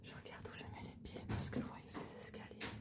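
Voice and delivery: hushed whisper, barely voiced; library whisper. Real, not acted. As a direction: whisper so no one hears. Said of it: "parlez moins fort, on vous entend".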